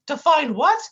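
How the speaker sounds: high goofy cartoon whine. Sidekick whining at his mean partner, high goofy voice. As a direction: plead like a hurt buffoon.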